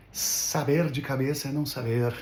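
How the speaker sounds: usual speaking voice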